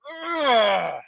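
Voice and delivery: a high, pained groan of frustration; frustrated groan. Real, not acted. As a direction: let out a fed-up ugh. Frustrated about company pressure.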